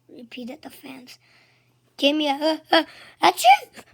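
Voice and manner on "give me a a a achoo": cheer into sneeze. A narrator's reading. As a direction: cheer that builds and bursts into a loud sneeze. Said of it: "sneezed the cheerleaders".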